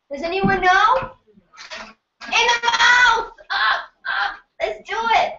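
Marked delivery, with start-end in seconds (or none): 2.2-3.3 high, slow, loud call-out; excited shout. Real, not acted. Shouting the answer to the class. 3.5-5.3 high, lively cheer to get the room going; rallying cheer. Real, not acted. Rousing the audience.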